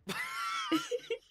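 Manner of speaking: very high, squealing laugh; shrieking laugh. Real, not acted. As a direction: burst out in a high-pitched cackle at your own joke. Cracks up at own aim joke.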